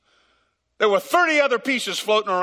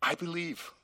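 quiet tearful God; 2 semitones lower, 11 dB quieter than usual. Acted. God speaking with tears.

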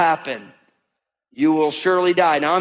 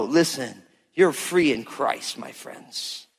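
softly reassuring; 7 semitones lower, 5 dB quieter, slower than usual. Real, not acted. Tender aside to congregation.

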